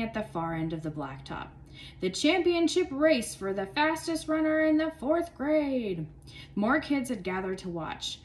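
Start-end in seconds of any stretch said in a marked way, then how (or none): 2.0-6.1 high, loud announcer call; sports announcer. A narrator's reading. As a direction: hype the crowd like a stadium announcer. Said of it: "in his sports announcer voice".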